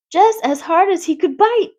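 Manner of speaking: ordinary delivery, nothing marked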